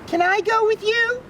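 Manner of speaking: put-on young girl begging to ride along; little girl voice. A narrator's reading. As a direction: ask like a small excited girl. Little girl asks tiger.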